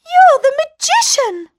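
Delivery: high shocked accusation; sudden realisation; accusing princess. A narrator's reading. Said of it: "said the princess".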